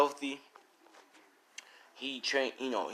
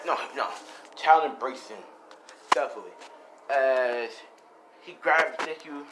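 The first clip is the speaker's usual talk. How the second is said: plain reading voice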